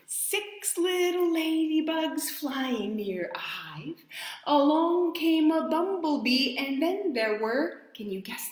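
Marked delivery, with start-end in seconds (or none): 0.1-4.0 high, whispered storybook rhyme; reading to children. Acted. Storyteller whispering the rhyme to little listeners. 4.5-7.8 high, louder sing-song build-up; reading to children. Acted. Storyteller building suspense for the children. Rhyme read aloud to kids.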